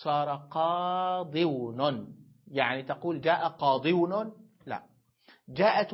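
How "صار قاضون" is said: slow repeated demo. Shown as a demonstration. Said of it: "فصار قاضون".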